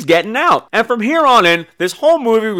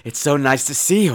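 soft, low, strained welcome; understated uneasy daughter; tense daughter; 5 semitones lower, 5 dB quieter than usual. Acted. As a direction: greet mama quietly with false sweetness. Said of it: "this weird tension".

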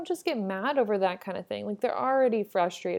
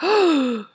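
a loud, high gasp of shock; sharp gasp; 7 semitones higher, 10 dB louder than usual. Retold or imitated. Retelling her shock at a stranger's words, sharp gasp. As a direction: suck in a startled breath. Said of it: "I was like".

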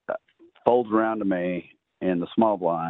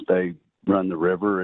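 ordinary talk

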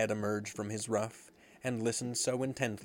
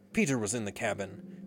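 ordinary delivery, nothing marked

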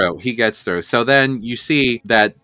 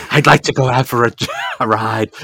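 lightly voiced sorceress, quick; a hint of a villainess; Shadow Weaver. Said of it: "Shadow Weaver's like".

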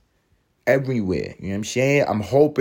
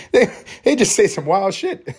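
high-pitched, laughing through the words; real laughing; 13 semitones higher, 4 dB louder than usual. Real, not acted. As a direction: crack up at your own bit while talking. Cracks up at own bit.

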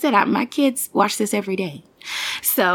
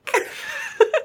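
hysterical laugh; 3 dB quieter than usual. Real, not acted. Big laugh after quote.

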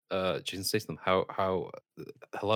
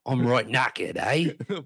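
hammed-up Cockney, louder and higher; mock British accent; 3 semitones higher, 6 dB louder, much slower than usual. Joking with friends in an exaggerated British accent. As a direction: put on a cheeky London bloke.

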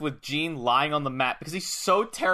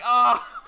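high, loud cry of pain; mock pain yelp; 9 semitones higher, 5 dB louder than usual. Gene sells his smacked chest, mock pain yelp. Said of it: "selling his chest".

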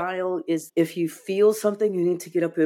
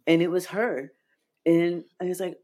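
usual speaking voice

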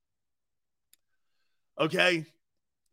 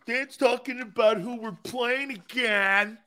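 normal talking voice

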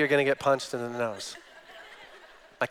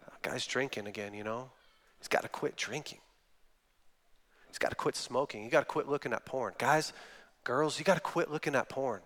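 mock disapproval; 2 semitones lower, 8 dB quieter than usual. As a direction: mutter the imitated nagging under your breath. Mimics people focused on symptoms.

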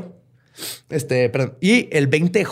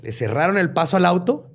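normal talking voice

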